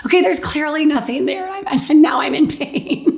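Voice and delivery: high, giggly protest; laughing complaint. Retold or imitated. Patient joking to herself about the pain, high and giggly. Her own thought in the scan.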